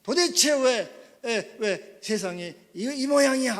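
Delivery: high, loud, drawn-out indignant questioning; acted anger. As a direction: protest furiously at the state of the world. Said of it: "하고 분노가 막 일어나는 것입니다".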